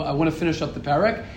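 ordinary talk, nothing marked

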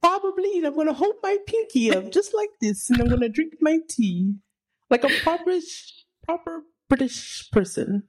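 very high, dainty fake British; mock British accent. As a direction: put on an exaggerated British tea-drinker's accent. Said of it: "We're working on the accent".

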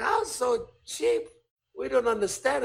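put-on high-pitched, baffled Japanese accent. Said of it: "here's my Japanese accent".

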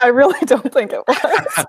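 laughing while talking. Real, not acted. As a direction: giggle through the words. Laughing reply.

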